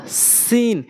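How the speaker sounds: সিন as a light Arabic s, pitched high; thin, held "seen" for সিন; spread mouth. Shown as a demonstration. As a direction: spread the lips a little on সিন. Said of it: "সিনটা একটু মুখটা ওসার হবে".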